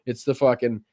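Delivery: normal talking voice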